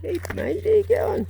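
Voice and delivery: high, sing-song dog greeting; talking to a dog. Real, not acted. A man greeting his friend's whippet, high sing-song.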